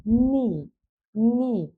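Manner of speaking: নি starting straight on N, no K; silent K. Shown as a demonstration. Teacher modelling knee for learners, নি with the K silent. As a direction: say নি as "nee", drawn out and clear. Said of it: "silent থাকে".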